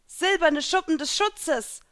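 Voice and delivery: high, loud, drawn-out spell cry; called out. A narrator's reading. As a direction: call out a spell in battle. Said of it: "rief ich".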